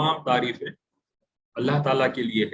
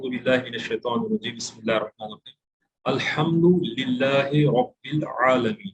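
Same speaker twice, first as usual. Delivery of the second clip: Quranic recitation; 2 semitones lower than usual. Shown as a demonstration. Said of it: "یہ ایک آیت کا ٹکڑا ہے".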